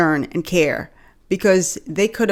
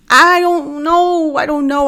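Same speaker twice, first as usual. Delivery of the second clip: high, very slow drawn-out I don't know; drawn-out stall; 9 semitones higher, 5 dB louder, slower than usual. Acted. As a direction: stretch it out, unsure. Stalling while thinking it over.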